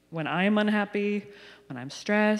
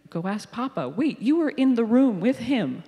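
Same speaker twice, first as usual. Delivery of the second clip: higher and louder, half-joking exasperation; exasperated mom, raised and higher; mock exasperated mom; 6 semitones higher, 5 dB louder than usual. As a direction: push the kids off to Papa, fed up. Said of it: "And I'm like".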